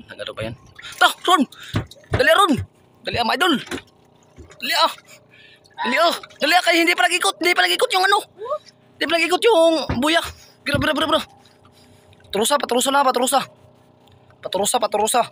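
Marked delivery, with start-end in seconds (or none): 1.0-10.2 high, loud urging shouts across the boat; calling out. Real, not acted. Urging Ron to pull the fish in, shouting loud and high. 10.6-15.2 excited urging. Real, not acted. Urging during the catch.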